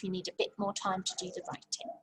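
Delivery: hushed whisper to the class; whispering teacher aside. Acted. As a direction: drop to a secret whisper.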